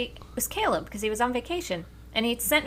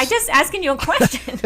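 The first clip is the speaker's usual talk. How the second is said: laughing through the words, higher and louder; 4 semitones higher, 4 dB louder than usual. Real, not acted. Retelling the text to her daughter, laughing aloud. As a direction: giggle it out.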